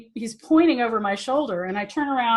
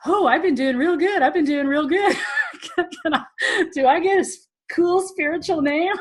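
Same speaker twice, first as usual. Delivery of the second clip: high, bright, hopeful begging; playing her naive younger self; eager pleading student; 9 semitones higher than usual. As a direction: angle for praise like a kid. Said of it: "I'm like".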